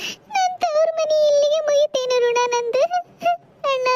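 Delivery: high, slow, tearful lament; weeping farewell in a high, slow voice; crying daughter. Acted. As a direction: cry out that her mother's home is lost to her. Daughter cast out by her family.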